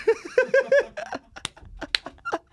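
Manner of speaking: helpless high-pitched laughter; real squeal of laughter at a silly story. Real, not acted. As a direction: burst into a shrieking laugh.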